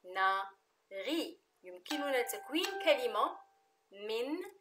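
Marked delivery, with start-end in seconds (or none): 0.0-1.4 "كناري" split into ka, na, ri with pauses; syllable by syllable. Shown as a demonstration. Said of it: "de trois syllabes".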